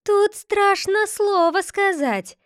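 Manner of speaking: high, slow, squeaky beak voice; piping mockery of the grandfather's grumble; squeaking bird beak. A narrator's reading. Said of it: "пискнул птичий нос".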